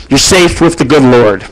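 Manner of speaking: usual speaking voice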